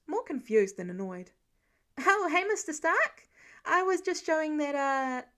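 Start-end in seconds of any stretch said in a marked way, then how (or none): 2.0-5.3 higher, louder flustered greeting; caught-out eager kid. Acted. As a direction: blurt it out, caught red-handed. Kid caught by Mister Stark.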